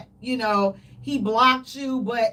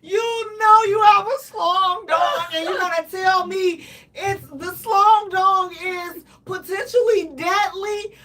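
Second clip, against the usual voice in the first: higher, louder, slow hammed-up sob; mock crying; 5 semitones higher, 5 dB louder than usual. To the partner, a hammed-up crying complaint. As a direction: blubber it out like a crying baby. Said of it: "I would cry like a baby".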